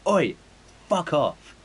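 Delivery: high, loud dismissive shout; mock angry call. Telling off a stranger messing with luggage, high mock shout. Acting out yelling at luggage thief.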